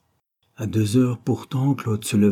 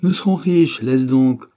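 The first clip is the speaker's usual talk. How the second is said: raised, louder, impatient scolding; irritated growl; 4 semitones higher, 6 dB louder than usual. A narrator's reading. Painter dismisses his partner's money worries, growling impatiently. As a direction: brush it off crossly, pitch jumping up. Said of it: "il gronda enfin".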